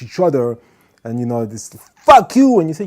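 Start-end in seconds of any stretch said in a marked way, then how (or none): 2.0-2.6 high, loud curse; mock shouting match. Two people screaming at each other, high loud curse.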